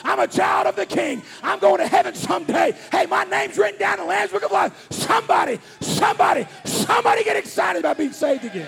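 loud, rising sermon climax; full-force preaching. Acted. Preacher to congregation, shouting salvation at full force. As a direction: preach it to the rafters, building.

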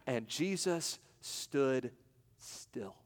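slow, dropping to a hushed "still"; preacher slowing to a hushed stop at the climax; dramatic hush. Acted. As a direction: let it hang in stillness.

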